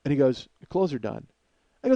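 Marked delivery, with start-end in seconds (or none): 0.6-1.3 low, soft, imitated calm; mimicking an unfazed neighbor; unbothered. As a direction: state it with no rise in pulse. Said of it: "his pulse doesn't get above sixty".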